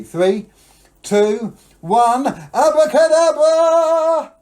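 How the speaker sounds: building loud countdown to a long shout; big magic countdown. Shown as a demonstration. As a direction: shout the magic word, stretched out. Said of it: "as loud as they can".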